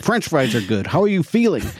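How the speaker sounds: mock-insistent counselor, pitch climbing on the question; counselor's joke. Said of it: "That was her big joke".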